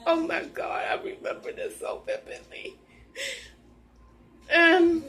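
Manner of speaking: real sobbing whisper, pitch high and breaking; overcome with tears. Real, not acted. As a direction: barely get the words out through crying. Overcome by the memory.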